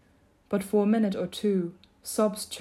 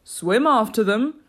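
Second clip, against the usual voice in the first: loud, drawn-out holler; loud creature yell; Gryphon scream; 3 semitones higher, 7 dB louder, slower than usual. A narrator's reading. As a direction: holler the order without raising the pitch much. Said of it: "screamed the Griffin".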